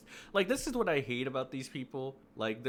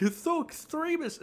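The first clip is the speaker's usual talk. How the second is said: much higher, sneering mock voice; mocking critic; 6 dB louder than usual. Mocking the people calling it extremist, high sneering voice. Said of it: "people are gonna be like".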